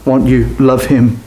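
normal talking voice

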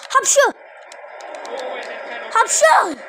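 sneezing. Real, not acted. Sneezing twice.

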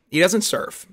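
ordinary talk

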